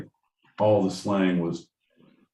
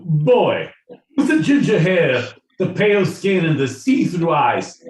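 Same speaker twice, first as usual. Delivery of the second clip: hammed-up posh toff, much higher and louder; mock posh aristocrat; 9 semitones higher, 7 dB louder than usual. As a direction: sneer down from a carriage at the commoners. Posh carriage rider mocking Londoner.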